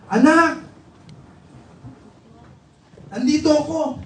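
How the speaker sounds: high, acted call to a child; father calling out. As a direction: call out to your son so he can find you. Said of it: "the voice of his father". (kunwaring tawag ng ama sa anak)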